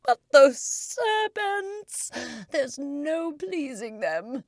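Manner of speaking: exasperated complaining; a high, slow, weary pigeon. Acted. Pigeon lamenting.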